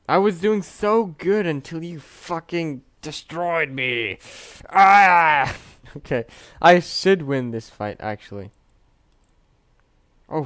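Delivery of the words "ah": frustrated yell. Real, not acted. Frustrated at loss.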